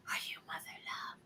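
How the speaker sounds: very soft whispered question; shy whisper. Retold or imitated. Young girl approaching a celebrity, whispering. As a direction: ease up close and whisper it. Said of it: "and she's whispering".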